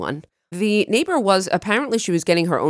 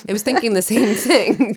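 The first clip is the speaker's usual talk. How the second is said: laughing; 5 semitones higher, 3 dB louder than usual. Real, not acted. Laughing at shared early waking.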